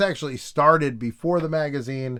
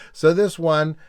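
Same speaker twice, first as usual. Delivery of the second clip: ordinary talk, nothing marked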